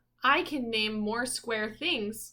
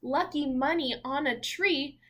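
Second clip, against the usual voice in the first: bright, louder picture-book reading voice; reading aloud; 4 semitones higher, 5 dB louder than usual. Acted. Parent reading a picture book to little Zeke, in a rhythmic storybook voice. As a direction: read each rhyme out like a storybook page.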